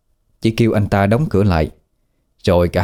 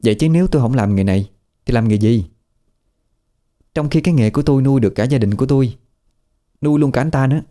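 higher female voice rising into a needling challenge; Trinh, sharp and defensive; 4 semitones higher, faster than usual. Acted. Trinh confronting Tú about his judging look, rising and needling. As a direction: call him out for judging her.

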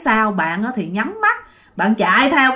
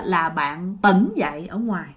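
usual speaking voice

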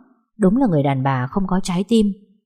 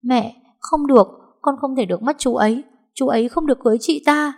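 van nài cao giọng, nghẹn ngào; khóc lóc níu lấy mẹ; bật khóc; 7 semitones higher than usual. A narrator's reading. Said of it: "Hương bật khóc ôm lấy mẹ". (giọng cao, khóc lóc van xin)

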